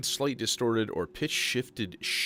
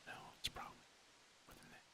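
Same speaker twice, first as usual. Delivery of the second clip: whispering; 14 dB quieter than usual. Real, not acted. Muttering aside while testing distortion.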